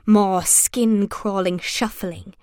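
usual speaking voice

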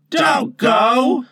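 high, loud call after someone leaving; pitying call. Acted. Boys call Tootles back, pitying shout. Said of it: "they called in pity".

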